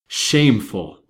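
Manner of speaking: loud burst of indignation; indignant exclamation. A narrator's reading. Banker hearing the wedding date is set, indignant exclamation. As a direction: exclaim it loudly, in outrage. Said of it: "ejaculated the banker".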